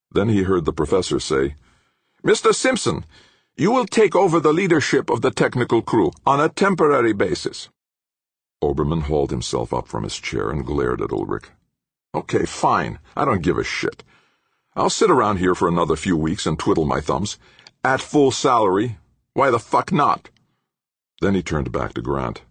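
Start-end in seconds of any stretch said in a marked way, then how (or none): 2.2-7.7 high-pitched, forceful order; commanding professor. A narrator's reading. Professor handing crew leadership to a staffer, sternly commanding. 12.1-20.3 higher-pitched resentful outburst, building; bitter defiance. A narrator's reading. Fired engineer telling off the professor, bitterly defiant.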